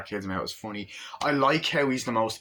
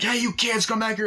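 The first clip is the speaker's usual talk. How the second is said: higher and louder, chasing them off; mock angry yell; 12 semitones higher, 5 dB louder than usual. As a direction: holler after the kids. Junkyard owner chases kids.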